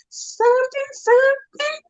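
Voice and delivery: very high, squeezed repeating; stuck on the word, voice climbing way up; strangled rage. Retold or imitated. Hearing Elmo won the part, very high strangled stammer.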